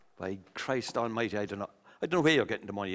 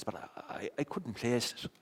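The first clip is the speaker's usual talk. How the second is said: ordinary talk, nothing marked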